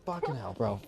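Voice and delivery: normal talking voice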